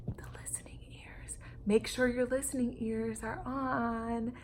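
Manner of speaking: breathy hushed secret; whisper. Acted. Teacher whispering to her listeners about listening ears. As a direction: whisper the reminder to the class. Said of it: "teacher whispers reminder".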